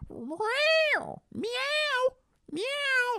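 very high, drawn-out imitated meows; high-pitched comic cat cries; dog meowing. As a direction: yowl like a cat, one meow after another. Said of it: "And then my dog goes".

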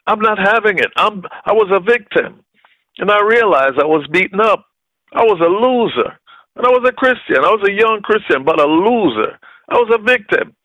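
high, heated self-pity; worked-up preacher reliving his fury; retold angry outburst. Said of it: "And I became what? Angry".

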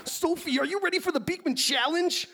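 high, hammy aroused professor; overheated nerd, played for laughs; lusty scientist voice. Plays Beekman.

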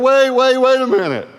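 big man scrambling to stop the preacher, panicked; panicked plea. Retold or imitated. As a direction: grab him before he leaves.

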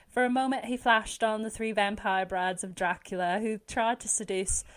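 amateur regional English accent reading; half-remembered British accent from an audition; attempted English accent. Shown as a demonstration. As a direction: read a novel aloud in a tentative Yorkshire accent. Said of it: "I'll just see if it's still in my brain".

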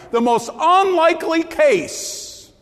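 ordinary delivery

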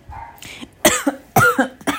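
coughing fit, choked on saliva; sputtering cough; choking cough. Real, not acted. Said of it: "Engasguei com a saliva".

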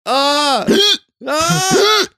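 sharp gasp then high scream; startled scream. Real, not acted. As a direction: gasp and shriek in fright. Startled by a jump scare.

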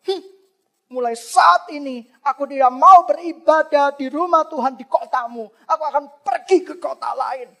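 loud, high furious outburst at the priest; Hana hypothetically raging at Eli, loud angry; angry rebuke. Pretend. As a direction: tell the priest off, then storm out. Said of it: "Dia bisa marah kepada Imam".

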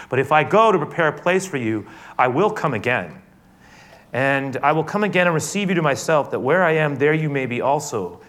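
loud, high impassioned promise; preaching at full force. Acted. Jesus promising his return to disciples, loud and fervent. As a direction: proclaim it with rising force.

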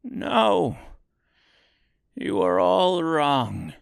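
high, meek little man. A narrator's reading. Little man admitting the truth, meek voice. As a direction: correct them meekly. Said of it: "said the little man meekly".

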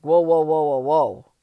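loud run of whoas, halting yourself; retold loud self-interruption; self-checking. Catching herself being negative.

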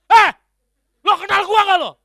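mock road rage. Man confronting the driver who cut him off, mock shouting. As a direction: square up to the other driver, loudly. Acted confrontation through car window.

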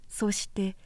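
plain reading voice

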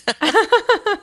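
high bright laugh; burst of laughter. Real, not acted. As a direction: laugh out loud. Laughing at funny story.

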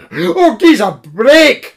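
high, loud excited cry; cried out. Acted. Koma crying out at a break, high and loud. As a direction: burst out with relief. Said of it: "cried Koma".

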